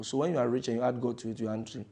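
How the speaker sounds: ordinary talk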